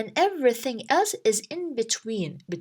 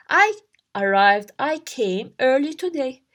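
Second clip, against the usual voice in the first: ordinary talk, nothing marked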